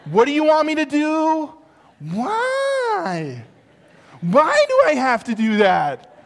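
put-on high, loud whining kid talking back; whiny kid. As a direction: play a stalling child questioning dad. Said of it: "Jimmy didn't go".

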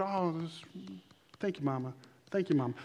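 soft, dutiful thank you; polite child thanks. Retold or imitated. Child thanking mom for small gifts, soft and dutiful. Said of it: "praising God for the things".

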